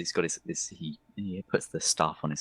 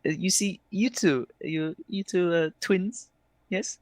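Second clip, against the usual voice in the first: high, probing elf voice; high-pitched, hesitant character questioning; flamboyant elf; 9 semitones higher, 4 dB louder than usual. Acted. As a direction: inquire delicately as a theatrical elf. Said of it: "He says".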